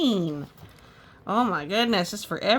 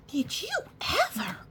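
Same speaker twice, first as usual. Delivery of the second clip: high gasped question; high breathless old lady; gasping grandma; 11 semitones higher than usual. Acted. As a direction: gasp it like a shocked grandma. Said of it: "Grandma gasped".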